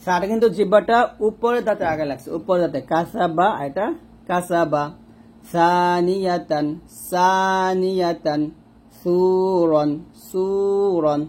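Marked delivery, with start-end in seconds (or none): 5.5-8.4 "সানিয়াতান" drawn out very slowly, each syllable clear; slow Arabic model. Shown as a demonstration. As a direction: stretch "সানিয়াতান" slowly as a demo. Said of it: "এরকম". ("সানিয়াতান" খুব ধীরে টেনে)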